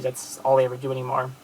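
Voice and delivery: ordinary talk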